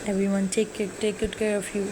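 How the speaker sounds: ordinary delivery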